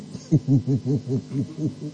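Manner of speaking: soft low chuckle in a rapid string. Real, not acted. Speaker amused by own remark about church, soft low chuckle. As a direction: chuckle quietly, low and rippling.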